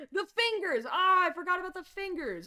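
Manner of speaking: high, loud sudden realisation; high excited exclamation; excited cry. Real, not acted. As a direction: blurt it out, thrilled. Remembering the fingers.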